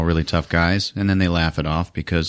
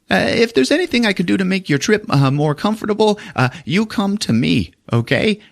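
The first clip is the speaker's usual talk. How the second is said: much higher, fast nervous groveling; sniveling weasel; 12 semitones higher than usual. Retold or imitated. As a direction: grovel quickly to get out of trouble. Nervous Grease Monkey sucking up.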